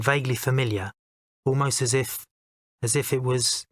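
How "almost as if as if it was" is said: plain reading voice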